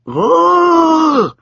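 high loud muffled cry; strangled high ugh. A narrator's reading. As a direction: squeal through a full mouth. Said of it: "said the old woman".

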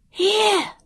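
very high, loud shriek; piercing, high-pitched cry. A narrator's reading. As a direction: shriek it out at the top of the register. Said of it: "she shrieked".

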